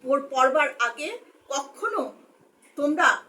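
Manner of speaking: ordinary delivery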